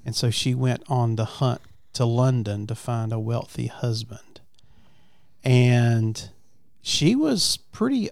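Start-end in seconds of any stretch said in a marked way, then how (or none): none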